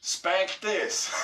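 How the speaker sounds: giggling through words; laughing. Real, not acted. Laughing at comment.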